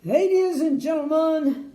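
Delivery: loud, grand king's proclamation; royal announcement. A narrator's reading. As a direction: announce it to the whole square like a king. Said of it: "the king announced".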